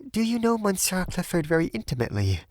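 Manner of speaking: high, anxious-to-know woman's voice; high-pitched, careful question; earnest young Frenchwoman. A narrator's reading. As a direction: ask in a high feminine voice.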